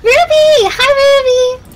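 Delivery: high, bright excited hello; excited greeting. Real, not acted. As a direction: squeal the name happily. Greeting a viewer by name.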